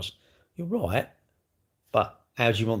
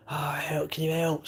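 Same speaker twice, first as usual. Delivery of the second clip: panicked neighbour's wife; 7 semitones higher, 3 dB quieter than usual. Retold or imitated. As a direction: lightly voice the frantic wife calling for help. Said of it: "Screaming help".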